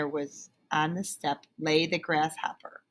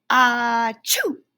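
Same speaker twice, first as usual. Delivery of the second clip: loud high achoo; loud hammed-up sneeze; 5 semitones higher, 8 dB louder than usual. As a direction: sneeze it out big. Grasshopper sneezes.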